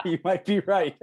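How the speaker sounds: high, loud laughing concession. Real, not acted. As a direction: burst out laughing and talk through it. Laughing at the sensei's joke.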